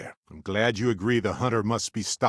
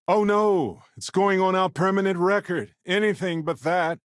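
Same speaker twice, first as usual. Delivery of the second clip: hammed-up sarcastic panic, higher and louder; mock dismay; 9 semitones higher, 4 dB louder than usual. Teasing the captain about a permanent record, mock panic. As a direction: fake horror, dripping irony.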